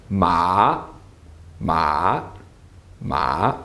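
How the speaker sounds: "Ma, ma, ma" each dipping low then rising; low fall-rise on "Ma, ma, ma"; dipping third tone. Shown as a demonstration. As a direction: drop low on each "ma", then swing back up. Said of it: "you fall a bit and then you rise back up".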